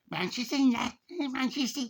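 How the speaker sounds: very high-pitched mock Manchester accent; cartoonishly high Manchester accent. Shown as a demonstration. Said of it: "That was my Manchester accent".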